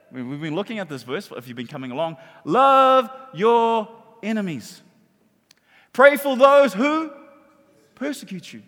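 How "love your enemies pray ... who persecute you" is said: very slow, drawn-out loud declaration; emphatic proclamation. Real, not acted. Preacher reciting Jesus' command to congregation, slow loud proclamation. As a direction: stretch each word high and strong.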